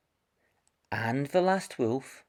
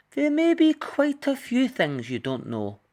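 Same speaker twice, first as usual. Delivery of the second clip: higher, curious kindly wolf; friendly wolf voice; 14 semitones higher than usual. Acted. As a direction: greet the child like a gentle old wolf. The last wolf answers Red.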